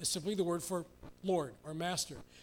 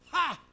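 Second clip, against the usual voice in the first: scornful laugh; 7 semitones higher, 6 dB louder than usual. Acted. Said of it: "laughs at these pretensions".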